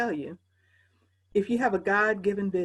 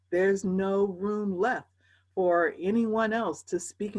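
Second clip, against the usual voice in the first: drawn out slowly, each word weighed; slow right down on every word; very slow emphasis; slower than usual. Real, not acted. Slowed emphatic repeat.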